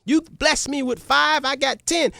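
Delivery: happy servant. Acted. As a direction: boast gladly to the king. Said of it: "was so happy".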